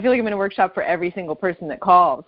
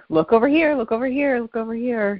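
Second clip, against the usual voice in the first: high-pitched luring call, easing down; mock distraction patter; 6 semitones higher than usual. Caller imitating a sleight-of-hand trick, playfully distracting. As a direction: call it high, as if diverting someone's eyes.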